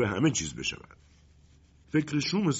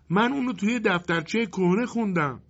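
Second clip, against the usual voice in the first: put-on very high little-boy voice; childlike voice; 12 semitones higher than usual. A narrator's reading. Boy piping up from the dark to help the wind, childlike voice. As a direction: pipe up like a child. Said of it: "صدایی کودکانه به گوش رسید".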